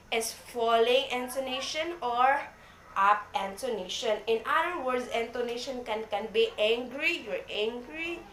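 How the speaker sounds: normal talking voice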